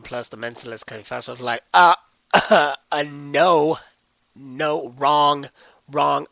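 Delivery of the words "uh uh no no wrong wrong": a loud laughing "no, no, wrong, wrong"; loud mock refusal. Vlogger replaying his retort to a friend, loud and mocking. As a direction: shoot her down loudly, half laughing.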